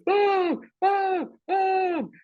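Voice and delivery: very high shrill calls of Mom; little brother runs in shouting for mom, very high; calling for mom. Retold or imitated. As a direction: yell for mom like a panicked kid. Kid yelling for mom.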